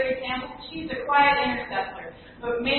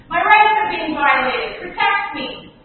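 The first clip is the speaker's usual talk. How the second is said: higher, louder cry for protection; insistent plea; 4 semitones higher, 6 dB louder than usual. A narrator's reading. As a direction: demand justice as the wronged widow. Widow pleading with the judge.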